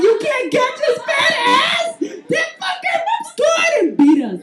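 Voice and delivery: screaming, very high and drawn-out outrage; hammed-up tearful sports rant; pissed-off fan. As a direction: wail in fury at the lost game. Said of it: "I was so pissed, man".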